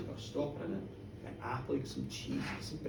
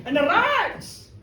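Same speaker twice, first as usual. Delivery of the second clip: ordinary delivery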